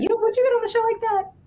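high, fast, put-on squeak; high squeaky question.

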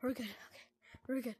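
breathless whisper of relief; hushed, reassuring himself; relieved whisper. Real, not acted. Hiding after escaping monster.

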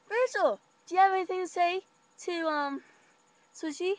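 high, loud mock teasing voice; teasing friend. Boyfriend's friend teasing Rachel in lunch line, high mock voice. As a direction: needle her like a smug boy.

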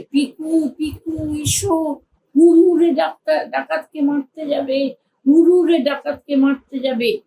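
high, bright magical calling voice; winged horse calling. A narrator's reading. The winged horse calls Piku to battle, high excited call. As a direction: call him out to fight the bandit.

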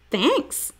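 high thanks with rising end; a short, lifted reply; teased boy replying. A narrator's reading. Stink to Riley after being called freaky, high-pitched reply.

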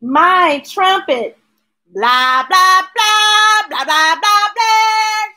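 loud high trumpet blahs; trumpet blasts. Acted. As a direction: blare it like a trumpet. Imitating a trumpet.

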